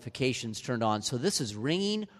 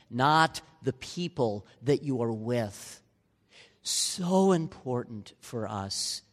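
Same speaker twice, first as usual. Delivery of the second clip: ordinary delivery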